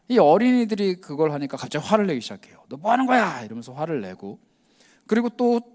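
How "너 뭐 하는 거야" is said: higher, sharp mock scolding. Temple leaders snapping at the children, mock scolding. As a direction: tell the kids off like an irritated elder.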